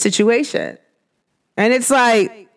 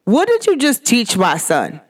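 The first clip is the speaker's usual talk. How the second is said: ordinary delivery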